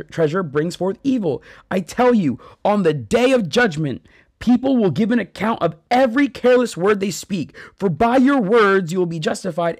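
forceful scripture reading as a rebuke; declaimed shout. Acted. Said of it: "he, he shouts".

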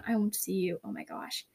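embarrassed whisper to herself after a slip. Real, not acted. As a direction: whisper it, caught off guard and giggling. Flustered after botching the outro.

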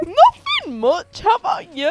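put-on British accent. Friends joking, repeating the line in a mock British accent. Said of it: "even better in a British accent".

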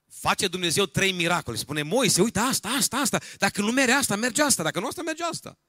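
loud, high-pitched rapid-fire sermon delivery; full-force preaching. Acted. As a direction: preach it loudly, piling up the phrases.

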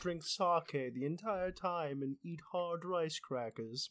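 slow, snooty purist; pompous voice. Acted. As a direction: savour every word smugly. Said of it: "that pompous voice".

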